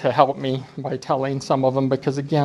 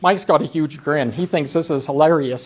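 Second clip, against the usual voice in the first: ordinary talk, nothing marked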